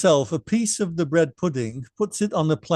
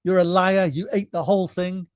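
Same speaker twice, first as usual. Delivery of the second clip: higher mock scolding mother; retold angry mother; 5 semitones higher, 4 dB louder than usual. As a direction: replay mom calling him a liar, half-yelling. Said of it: "She said".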